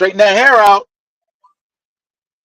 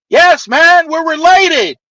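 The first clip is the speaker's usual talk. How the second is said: excited insisting; 9 semitones higher, slower than usual. Retold or imitated. Insisting to stunned uncle.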